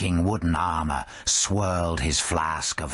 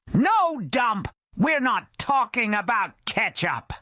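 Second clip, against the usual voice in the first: exasperated snap. Acted. Skeleton Joe snapping at Dump about ketchup. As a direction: shut him down.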